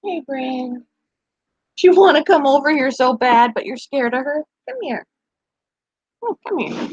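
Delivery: cooing to a nervous puppy; coaxing pet talk. Real, not acted. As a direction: coax the shy dog over gently. Coaxing the dog to come over.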